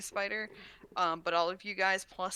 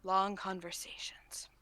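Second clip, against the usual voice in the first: whisper; 3 dB quieter, slower than usual. Acted. Deadpan answer in a whisper.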